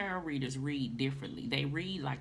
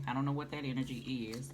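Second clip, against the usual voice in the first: ordinary talk, nothing marked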